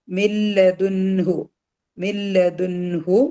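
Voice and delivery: slow മില്ലദുൻഹു with a distinct n before hu; മില്ലദുൻഹു drawn out, n kept separate from h; clear noon. Shown as a demonstration. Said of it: "നൂനിന്റെ സൗണ്ടിനെ clear ആയിട്ടാണ് pronounce ചെയ്യുക".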